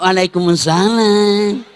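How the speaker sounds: slow, drawn-out sweet greeting; a tender lilting answer; mock syahdu reply. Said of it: "itu tadi sahdu namanya".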